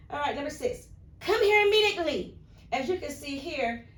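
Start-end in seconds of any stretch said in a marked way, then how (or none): 1.2-2.4 much higher and louder urgent command; excited command. Shown as a demonstration. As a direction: call it out sharply, demanding. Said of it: "supposed to be said with excitement".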